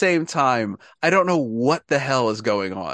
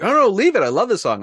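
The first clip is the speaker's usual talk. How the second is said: eager protest; 8 semitones higher, 4 dB louder, faster than usual. Retold or imitated. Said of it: "He's like".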